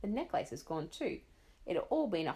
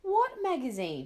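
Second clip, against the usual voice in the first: absent-minded; 7 semitones higher, 4 dB louder, slower than usual. Acted. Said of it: "she said absent-mindedly".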